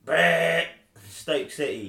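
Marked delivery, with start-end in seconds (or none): 0.0-0.7 loud, high imitated buzzer noise; mock time-up buzzer. Quizmaster ending the five seconds, loud mock buzzer. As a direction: buzz them out sharply.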